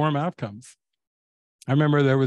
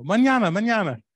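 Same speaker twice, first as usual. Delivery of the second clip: high, bright and breezy, a louder sing-song mañana; laughing brush-off; 8 semitones higher, 4 dB louder than usual. Retold or imitated. Mexican friends putting off repairs, breezy high sing-song. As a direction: toss it off with a laugh, no hurry at all. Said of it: "when they laugh and they go".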